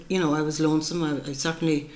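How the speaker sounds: normal talking voice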